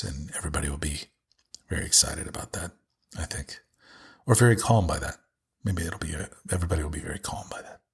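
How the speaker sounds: low, breathy whispered ASMR voice. Shown as a demonstration. Demonstrating his planned ASMR readings in a soft whisper. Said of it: "just talk like this".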